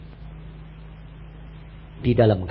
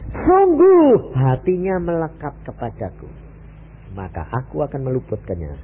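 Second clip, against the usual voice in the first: solemn scripture reading; 3 semitones lower, 3 dB louder than usual. Acted. Quotes God's words from the psalm.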